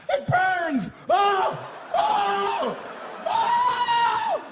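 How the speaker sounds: very high, loud shrieks of pain; agonized screaming. Acted. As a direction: scream like your face is burning. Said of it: "This guy was freaking out".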